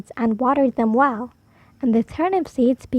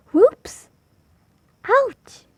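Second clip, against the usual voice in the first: 1 high yelps; 10 semitones higher than usual. Acted. Everyone falls back.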